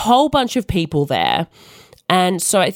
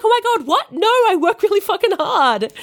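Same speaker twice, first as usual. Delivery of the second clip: much higher, shocked and defensive; indignant disbelief; 13 semitones higher than usual. Retold or imitated. Telling about husband's family, high indignant disbelief. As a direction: protest that you work hard, pitched way up.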